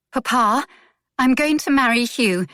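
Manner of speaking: plain reading voice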